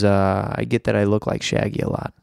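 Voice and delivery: ordinary talk, nothing marked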